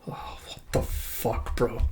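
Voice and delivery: slow whispered swearing; real hushed reaction, no voice; stunned whisper. Real, not acted. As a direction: breathe it out in quiet disbelief. Reader stunned by the ending.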